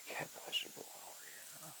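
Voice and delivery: hushed, barely voiced whisper to himself. Real, not acted. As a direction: mutter it under your breath, almost no voice. Muttering to himself at the bench.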